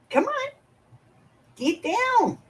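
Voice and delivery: high, sing-song pet coaxing. Real, not acted. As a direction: coax the cat down sweetly. Coaxing cat off her lap.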